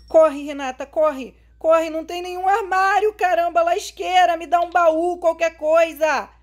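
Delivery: loud frantic panic; panicked shouting. Real, not acted. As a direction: yell while running in fear. Fleeing, no hiding place. (gritando em pânico)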